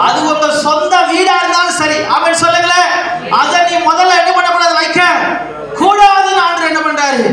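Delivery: high, insistent preacher's call; impassioned preaching. Real, not acted. Preaching at raised pitch. (உணர்ச்சி பொங்கும் உயர்ந்த குரல் பிரசங்கம்)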